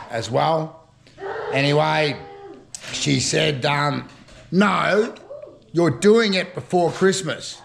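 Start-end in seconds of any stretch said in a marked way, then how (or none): 4.5-7.6 mock demanding, slow and a bit higher. Retelling a woman ordering him, mock demanding. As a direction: imitate a bossy woman insisting. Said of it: "Like she was demanding".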